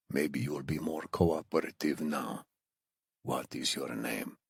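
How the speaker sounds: put-on low, hushed Iranian-accented menace; quiet, controlled captor; accented interrogator. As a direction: question the prisoner softly, with a thick accent. Captor questions bound prisoner.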